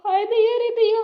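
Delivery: high, loud cry of dismay; dismayed wail. Acted. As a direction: wail in theatrical horror. Finds herself bald, laments.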